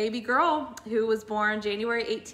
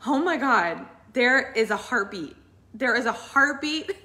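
higher, louder retelling of the tech's cry; retold cry of joy, higher and louder; excited yell; 5 semitones higher, 5 dB louder than usual. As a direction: imitate a stunned tech shouting the news. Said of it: "she yells out".

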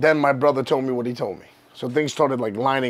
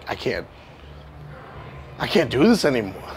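a hushed, defeated admission, softer and lower; quiet despair; 3 semitones lower, 3 dB quieter than usual. Retold or imitated. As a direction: let it sink, quiet and beaten. Despair at the broken wall.

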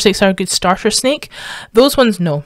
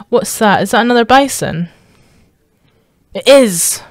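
normal talking voice

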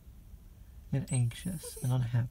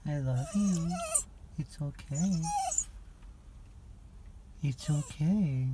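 gentle, higher pet-soothing voice; soothing a scared dog; 9 semitones higher, 4 dB quieter than usual. Real, not acted. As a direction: comfort a crying puppy softly.

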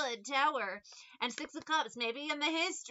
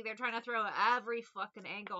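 ordinary delivery

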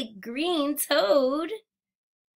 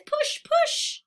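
much higher, eager push push; excited cheering. Acted. Urging kids to help the truck, high eager cheer. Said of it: "Let's try and push, guys".

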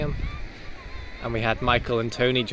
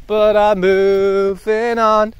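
loud; 8 semitones higher, 8 dB louder, much slower than usual. Pretend. Mock announcement, loud and slow.

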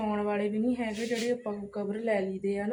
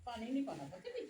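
put-on high, sing-song coaxing to a toddler; baby talk; 8 semitones higher, 12 dB quieter than usual. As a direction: coax the little one gently. Mother coaxing her little child. (ਬੱਚੇ ਨਾਲ ਤੋਤਲੀ ਲਾਡ ਭਰੀ ਬੋਲੀ)

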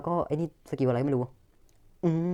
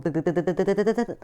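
vocal beeping; 4 semitones higher, 3 dB louder than usual. Pretend. Mouth sound while cards open.

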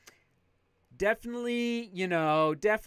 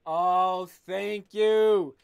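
louder, beaming thank you; delighted thanks; 5 dB louder, much slower than usual. Real, not acted. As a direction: gush your thanks. Unboxing new shoes, delighted.

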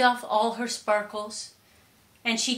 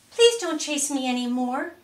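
pleading request; 6 semitones higher, 6 dB louder than usual. A narrator's reading. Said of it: "she asked the screaming crowd".

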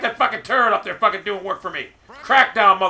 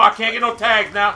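ordinary talk, nothing marked

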